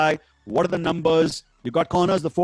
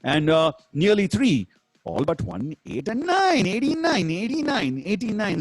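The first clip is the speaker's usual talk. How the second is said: bingo caller=1; 4 semitones higher, slower than usual. Acted. Bingo number calls.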